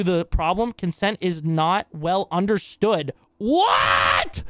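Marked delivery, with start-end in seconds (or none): 3.4-4.4 loud disbelieving cry of what; shocked what. Real, not acted. Reacting to the clip, a loud shocked shout. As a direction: shout it like you can't believe your ears.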